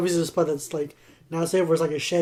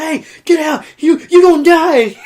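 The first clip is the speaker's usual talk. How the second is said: higher and louder, slow final warning; frantic loud warning; mock frantic shout; 10 semitones higher, 10 dB louder than usual. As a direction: bellow the warning at the screen. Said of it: "He's like".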